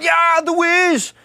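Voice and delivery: high, loud, slow put-on voice of a fan; high, loud, slow impression of a fan confirming the Wiz; drawn-out fan voice. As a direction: stretch it out like a delighted drunk fan. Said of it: "He went".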